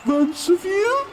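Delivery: lightly voiced host calling to the crowd, high and loud; mock announcer question. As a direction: play the host asking the crowd. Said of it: "Meta D asks crowd".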